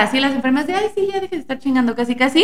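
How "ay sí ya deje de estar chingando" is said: mocking nurse. Nurses voiced mocking mother.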